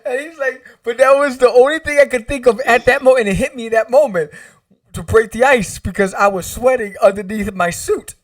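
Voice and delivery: high, loud, laughing as he talks; excited retelling bubbling with laughter; real laughing. Real, not acted. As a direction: tell it while cracking up. Cracking up retelling his own joke.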